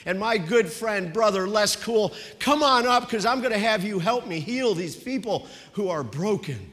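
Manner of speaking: loud, fast, upbeat announcement to the room; excited announcing. Retold or imitated. Pastor announcing the healing service and calling his friend up, loud and excited. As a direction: call the crowd to the healing service with growing excitement. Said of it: "and he says".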